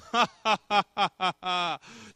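long staccato mocking laugh; scornful laugh at a beaten foe; malicious laugh. God laughing at the family's enemy, mocking laugh. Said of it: "God looks down and says".

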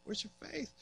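quiet, fading question; soft reproach. Retold or imitated. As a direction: let it trail off gently. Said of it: "And Jesus is like".

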